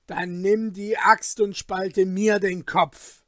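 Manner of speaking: loud, despairing outburst; Falke, desperate. Acted. Falke to his friend over the cow, desperate shout. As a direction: throw it out in desperation. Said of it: "schrie Falke mit verzweifeltem Tone".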